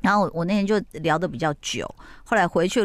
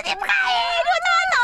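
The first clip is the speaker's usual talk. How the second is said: mock roaring protest; much faster than usual. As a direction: howl in outrage as he leaves. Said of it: "就这么一直咆哮".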